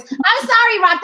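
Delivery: a hint of a put-on man's voice, lightly deepened; generic man voice. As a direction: play a generic guy lightly. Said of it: "That's my generic man voice".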